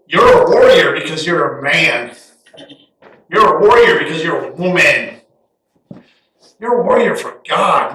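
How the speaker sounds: ringing preached declaration; declaring warrior. Acted. Preacher telling the church they are warriors, ringing. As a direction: lean hard on warrior each time. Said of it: "as you hear me say".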